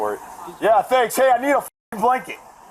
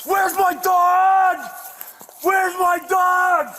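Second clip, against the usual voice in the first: desperate shout; 9 semitones higher, much slower than usual. Real, not acted. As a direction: howl out for the lost dog. Demanding his dog, yelling.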